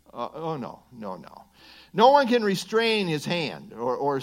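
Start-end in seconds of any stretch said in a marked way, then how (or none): 0.1-1.4 low, soft dismissal; quiet, dropped-pitch retreat; backing off. Acted. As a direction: wave the idea away under your breath. Backing off from the mock confrontation.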